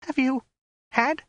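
hesitant, very high falsetto woman; frightened, faltering question; strangled squeak. A narrator's reading. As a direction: squeak it haltingly, word by word. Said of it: "in a hesitant, strangled squeak".

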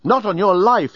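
high, indignant refusal; exclaimed. A narrator's reading. Nettie refusing to leave the cell, mildly exclaimed. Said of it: "exclaimed Nettie".

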